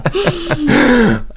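high giggle after a joke; high laugh. Real, not acted. Laughing at his own joke to friends, high laugh.

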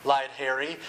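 usual speaking voice

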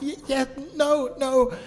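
high, halting mock-tearful voice; imitated tearful fan. Fan at the convention table answers, imitated shaking and crying. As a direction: act out an overwhelmed fan in tears.

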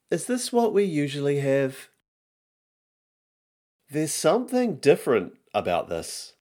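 put-on high-pitched kid suspicious of dinner; fussy child. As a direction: complain high like a reluctant child. Lists kids' complaints about dinner.

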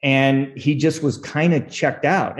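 usual speaking voice

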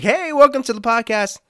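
over-the-top cheerful welcome; cheery and happy. Shown as a demonstration. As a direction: beam it out like a happy host. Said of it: "Normally, I'm all cheery and happy, like".

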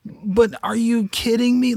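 high, slow exasperated "kidding"; an imitated high exasperated whine; frustrated mock outburst. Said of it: "you know, like".

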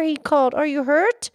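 storybook reading voice; story narration. Acted. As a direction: read it like a bedtime story. Reads a children's story aloud.

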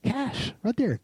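high, delighted exclamation; mock glee at the payout; excited. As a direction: squeal about the cash. Excited about getting paid.

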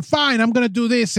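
high-pitched petulant retort; mock huffy defiance. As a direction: play someone giving in out of spite. Acts out argument retort.

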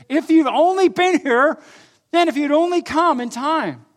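retold angry reproach, very high and loud; Mary. Mad sister confronts Jesus for coming late, retold anger. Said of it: "and she's mad".

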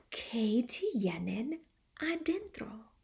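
hushed, slow wondering whisper; a child's storybook whisper; curious whisper. A narrator's reading. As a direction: breathe the question like a secret. Curious bear wonders inside.

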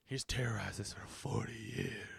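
low, hushed movie-trailer gravity; mock dramatic whisper. Mocking a film character's grave line, whispered.

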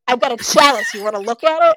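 loud mock-sarcastic offer; brazenly sarcastic, punched up for the retelling. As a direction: toss it out as an obvious tease. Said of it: "in a really sarcastic tone of voice".

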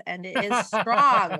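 slow, loud, weighty; a member warning of Adonitis, slow and heavy; mock ominous emphasis. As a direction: warn them it's serious, tongue in cheek. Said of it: "mock-grave warning about Adonitis".

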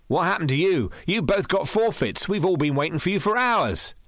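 eager boy, lightly voiced. A narrator's reading. Reggie voiced, higher.

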